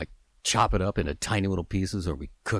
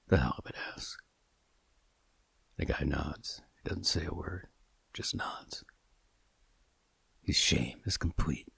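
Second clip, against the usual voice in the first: hushed storyteller whisper; quiet breathy-free whisper telling the scene; whispered narration; 3 semitones lower, 7 dB quieter than usual. A narrator's reading. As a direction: drop to a whisper for the shameful moment.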